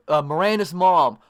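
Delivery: ordinary delivery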